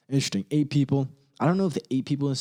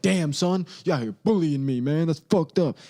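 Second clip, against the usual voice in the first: mock hurt LeBron; 6 semitones higher, 4 dB louder than usual. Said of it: "And LeBron's like".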